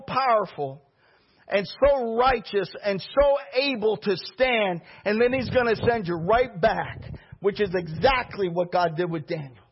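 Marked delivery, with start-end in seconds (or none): none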